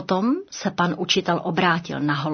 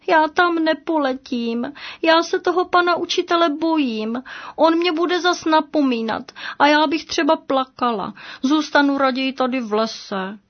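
put-on high, small scared girl dove voice; frightened little dove; 7 semitones higher than usual. Little dove tells her brother she fears the teacher, high and scared. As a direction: plead you won't go to school.

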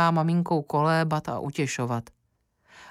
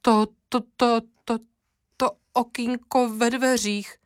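high, stammering and frightened; the cook can't get the words out for fear; trembling stammer; 8 semitones higher than usual. A narrator's reading. Cook stammering to the lady about the broken window, terrified. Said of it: "koktá rozechvělá kuchařka".

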